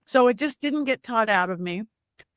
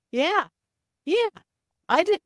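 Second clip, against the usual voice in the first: nervous cracking voice; 9 semitones higher than usual. Retold or imitated. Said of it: "My voice cracked".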